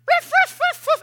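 loud, very high yappy bark; squeaky little bark. Retold or imitated. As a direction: yap like a tiny dog. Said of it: "high-pitched little squeak bark thing".

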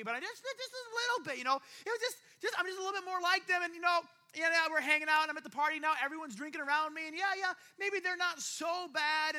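high-pitched, wheedling excuses; mock rationalizing. Compromiser justifying being at the party, high-pitched rationalizing.

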